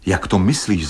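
ordinary delivery, nothing marked

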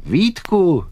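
high, loud call across the yard; shouted name. Acted. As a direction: holler to the boy over a distance. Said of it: "křičel hajný".

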